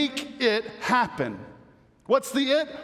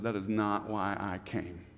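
grave quiet; 12 semitones lower, 9 dB quieter than usual. Acted. Jesus quoted, gravely.

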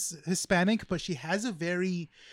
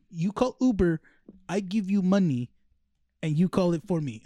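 louder, emphatic mock Russian accent; 5 dB louder than usual. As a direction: spell out the deal in an imitated Russian accent. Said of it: "it sounds like a Russian accent".

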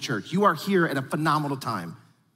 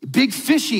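put-on playful kid talk; 5 semitones higher, 4 dB louder than usual. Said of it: "the word is actually fishy".

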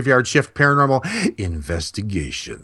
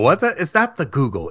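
ordinary talk

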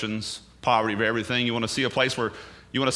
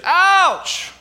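very high, loud ouch from the next room; yelp of pain; 10 dB louder than usual. Shown as a demonstration. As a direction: yelp like you just got hurt. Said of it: "you heard me say".

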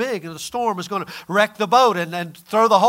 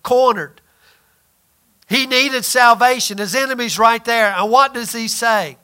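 ordinary talk, nothing marked

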